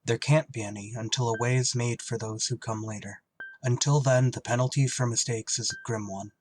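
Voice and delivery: grave, measured narration; story narration. A narrator's reading. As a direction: set the scene like a lone pilot's log.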